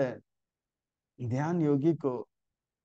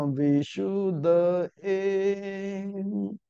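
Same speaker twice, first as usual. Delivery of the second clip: slow, louder chanted Sanskrit verse; श्लोक पाठ; 3 semitones higher, 3 dB louder, much slower than usual. Acted. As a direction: recite the shloka slowly, drawing out each word. (धीमा, सस्वर संस्कृत श्लोक पाठ)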